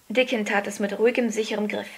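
usual speaking voice

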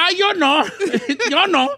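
high, laughing refusal; mock refusing mother. Widowed mother telling son she's out, mock refusal.

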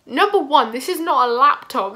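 loud, emphatic objection to the proctors; loud exasperated protest. Retold or imitated. Student protesting loudly to exam proctors about the laptop. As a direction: insist loudly, fed up.

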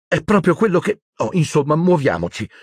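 high, impatient cut-off; brisk, high exasperation; cutting short. A narrator's reading. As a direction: hurry them along. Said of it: "tagliò corto Hermione".

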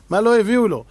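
normal talking voice

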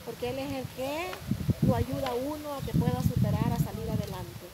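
loud cheerful thanks to viewers; vlog address. Acted. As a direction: greet your audience warmly.

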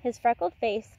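ordinary delivery, nothing marked

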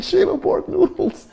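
very high, slow pretend wail; mock crying. Telling Emma why he's crying over noodles, mock crying. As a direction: wail it slowly, half laughing. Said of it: "[name], why you crying?".

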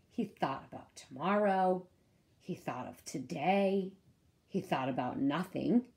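ordinary delivery, nothing marked

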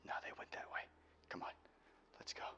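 very soft whisper. Acted. Hiding from pursuers with partner, whispered. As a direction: keep it silent, they're close.

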